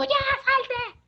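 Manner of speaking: high shouted command to the dog; mock yell. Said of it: "no dije grítalo". (grito agudo imitando al hijo)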